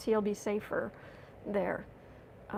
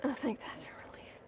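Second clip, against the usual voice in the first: hushed whispered aside; whispering; 3 semitones higher, 10 dB quieter, faster than usual. Real, not acted. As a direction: whisper it like a secret relief. Quiet aside to viewers.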